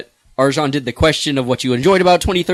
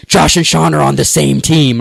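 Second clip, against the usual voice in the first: louder, slow mock-alarmed whine; mock dismay; 4 semitones higher, 6 dB louder than usual. Host mimics players seeing the organisers share a team, mock dismay. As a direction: imitate players dreading a bad draw. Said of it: "people are always like".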